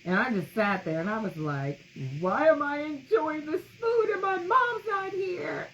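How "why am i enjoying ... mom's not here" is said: tearful question. Retold or imitated. Retelling grief at dinner.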